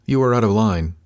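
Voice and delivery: measured, serious reprimand; grave warning, mildly played. A narrator's reading. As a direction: state it very seriously. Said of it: "He said it very seriously".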